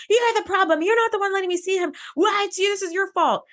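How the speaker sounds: put-on high-pitched, rushed child's tantrum; kid tantrum yelling. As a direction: imitate a panicked kid hurling blame, high and fast. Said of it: "Yelling and screaming at Troy".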